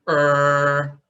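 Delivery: "er" luid met open mond; open-mouth er. Shown as a demonstration. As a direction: open wide and say "er". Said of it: "If you just open your mouth, say".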